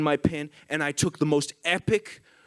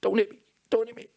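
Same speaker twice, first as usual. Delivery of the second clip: much higher, fast panicked squeak; mock cowering plea; 11 semitones higher, faster than usual. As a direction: squeal it like you're about to be hit. And I'm like.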